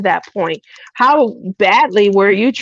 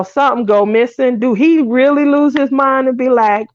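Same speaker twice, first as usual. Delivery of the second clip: usual speaking voice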